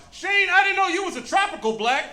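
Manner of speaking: high-pitched teammate calling out; put-on high holler of astonishment; hollering kid. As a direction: imitate a stunned kid hollering. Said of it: "just hollered out".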